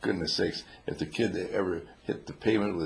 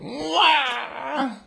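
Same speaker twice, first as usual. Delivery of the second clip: long high mock baby cry; loud put-on "waah"; imitated newborn wail; 4 dB louder than usual.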